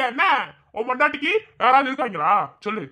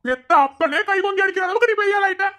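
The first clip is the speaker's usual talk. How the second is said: higher, outraged scolding; indignant outburst; 6 semitones higher than usual. Acted. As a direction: hurl it at the son in disbelief. Father scolds son for hitting him.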